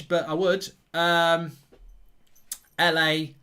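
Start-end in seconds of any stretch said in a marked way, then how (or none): none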